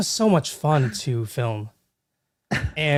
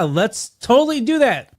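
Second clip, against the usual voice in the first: drawn-out, higher fake agreement; sarcastic; 7 semitones higher, 4 dB louder, slower than usual. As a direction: agree in an obviously insincere way. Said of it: "we are absolutely not doing that".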